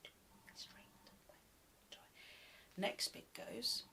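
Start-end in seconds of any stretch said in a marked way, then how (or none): 0.0-2.0 whisper. Shown as a demonstration. Teacher whispers song line.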